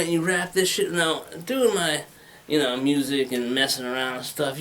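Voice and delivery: usual speaking voice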